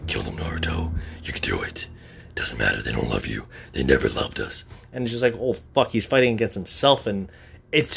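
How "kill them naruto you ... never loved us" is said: very low, breathy demon whisper; tempting whisper of the inner demon. Retold or imitated. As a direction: hiss it like a voice in his head. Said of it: "He's like".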